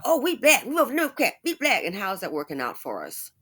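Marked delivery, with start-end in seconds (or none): 0.1-1.8 high, laughing mock voice; mock voter. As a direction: lampoon the voter, much higher and laughing. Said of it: "So they're not worried about".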